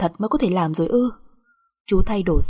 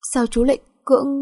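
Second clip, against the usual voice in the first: higher, pained question; accuse, pitch lifted in hurt; upset accusation; 5 semitones higher than usual. Acted. Wife confronting husband after the hotel, upset.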